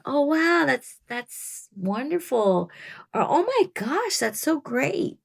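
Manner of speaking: gushing excitement. Retold or imitated. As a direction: act thrilled and a little overdone. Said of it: "I'm not an actress".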